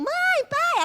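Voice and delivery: very high hammed-up cry for parents; mock screaming. Kidnapped daughter calls to her parents, mock scream. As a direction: squeal for rescue, theatrically. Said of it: "Vai gritando".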